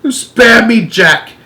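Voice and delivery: high, loud cry for mercy; pleading yell. Acted. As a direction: beg in panic. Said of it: "he yelled".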